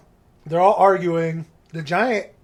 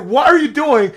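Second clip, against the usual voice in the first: high, loud caught-you yell; mock angry scolding; 7 semitones higher, 8 dB louder than usual. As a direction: act out scolding a thief in the act. Giant catches Dudley eating sausages.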